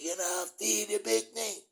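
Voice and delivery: very loud, high-pitched imitated yell; hammed-up shout, drawn out and high; retold outburst. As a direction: holler it high, stretching the words. Retold outburst at his cousin.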